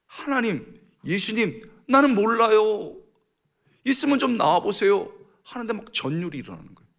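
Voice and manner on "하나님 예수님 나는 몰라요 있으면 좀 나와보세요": loud, high, drawn-out cry to God; reenacted panicked prayer, voice raised; frightened plea. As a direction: cry out desperately for God to appear. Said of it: "너무 무서워가지고".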